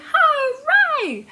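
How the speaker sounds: high, loud joyful cheer; shouted hooray. Acted. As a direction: cheer it out for the kids. Song says shout hooray.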